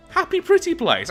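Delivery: very high, playful sing-song lilt; playful cutesy voice. Pretend. Gamer playfully selling his utopia world, cutesy high voice. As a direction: pitch it way up, mock-sweet.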